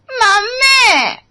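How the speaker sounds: high, drawn-out "mummy" crying for mom; sister-in-law wails for her mother in a long high cry; childish whining wail. Acted. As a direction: whine it like a sulking child.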